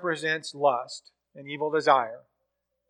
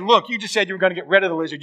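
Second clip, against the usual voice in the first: fast, louder, higher heated complaint; angry complaint; 4 semitones higher, 6 dB louder, much faster than usual. Retold or imitated. Ghost man angrily accuses the angel, fast and louder. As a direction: snap back at the angel, rushing. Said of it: "And the man gets angry".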